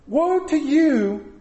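woe stretched out, slow and loud; slow preaching woe. Acted. Preacher thunders the woe at his sons, slow and loud. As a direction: drag out "Woe" like a thunderous pronouncement. Mock woe over dirty dishes.

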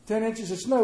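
high mock-fretful oh; teasing the worried congregation; mock worry. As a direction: ham up the panic. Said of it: "everybody was worried".

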